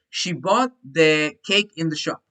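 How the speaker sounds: slow, careful reading; teacher reading the exercise aloud; slow model reading. Shown as a demonstration. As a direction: read the sentence slowly. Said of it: "teacher reads sentence to class".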